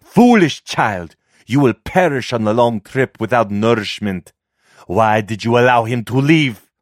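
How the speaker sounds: mother berating her daughter for letting the man go, angry. Acted. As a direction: scold the foolish child, furious. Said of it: "eyes glowed amber with anger".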